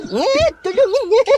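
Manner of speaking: high squeaky plea for help; squeaky creature pleading. Acted. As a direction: beg like a tiny hurt puppet. Pot minta tolong. (suara cicit memohon tolong)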